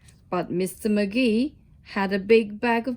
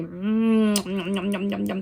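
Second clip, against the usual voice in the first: hungry crocodile; 4 semitones lower than usual. Acted. Crocodile savouring, lower voice.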